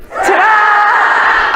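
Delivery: loud shouted ta-da; bellowing. Real, not acted. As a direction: bellow it like a sports arena. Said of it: "in your loudest voice as if you were in a sports arena".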